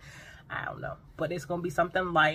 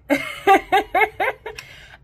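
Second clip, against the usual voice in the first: loud, high cackle; big burst of laughter; 12 dB louder than usual. Real, not acted. Vlogger cracking up at her own summer brag, loud cackle.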